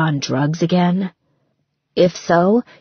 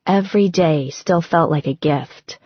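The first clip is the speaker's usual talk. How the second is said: ordinary delivery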